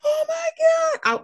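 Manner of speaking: high gasping oh my God; shocked cry. Real, not acted. Reacting to the baby scene, a shocked high cry.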